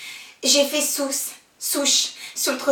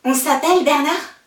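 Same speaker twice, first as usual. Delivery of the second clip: raised, hopeful call; calling after him; 4 semitones higher, 8 dB louder than usual. Acted. Girl calling after Bernard as he walks off, loud and hopeful. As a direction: call out loud with a rising question.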